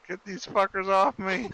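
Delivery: high-pitched cry for help; in-character shout. Acted. Player shouts for help under a goblin pile, high shout. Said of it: "I shout".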